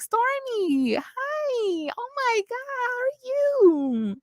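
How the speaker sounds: very high, slow, syrupy hello; mock Melody's fake sweet greeting; sugary high greeting. Vlogger mimicking Melody's sugary greeting to Stormy, very high.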